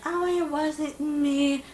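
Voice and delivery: slow, soft, sneaky denial; mock innocent. Imitating the girl caught recording, slow fake innocence. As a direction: play innocent, drawn out and quiet. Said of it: "Literally trying to be sneaky".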